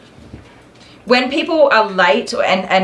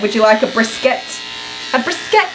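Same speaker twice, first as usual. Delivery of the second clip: teasing husband imitation; 3 semitones higher than usual. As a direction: tease him by copying his line. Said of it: "I'm like".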